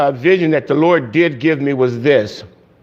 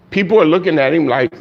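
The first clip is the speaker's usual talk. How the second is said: usual speaking voice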